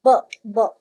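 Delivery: ब, ब given twice as short, punchy bare sounds, loud and clear. Shown as a demonstration. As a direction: tap out the ब sound for the class. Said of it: "the sound is ब".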